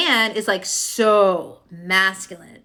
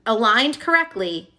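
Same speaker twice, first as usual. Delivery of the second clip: usual speaking voice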